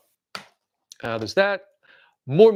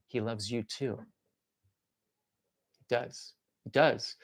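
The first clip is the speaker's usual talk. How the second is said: soft reassuring; 9 semitones lower, 9 dB quieter, slower than usual. Pretend.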